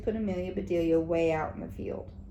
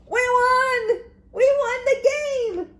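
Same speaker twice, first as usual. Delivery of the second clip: very high and much louder victory yell; joyful yell; 9 dB louder than usual. A narrator's reading. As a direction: scream the win after her. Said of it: "yelled the boys".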